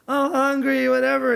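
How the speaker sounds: put-on high, loud whiny kid voice; a kid complaining she's hungry; whiny daughter.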